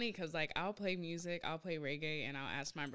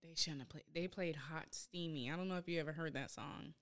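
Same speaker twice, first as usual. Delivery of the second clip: normal talking voice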